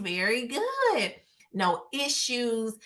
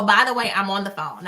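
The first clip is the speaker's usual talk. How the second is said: ordinary delivery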